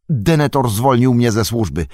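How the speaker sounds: put-on a young hobbit blurting out his excuse; Pippin voice.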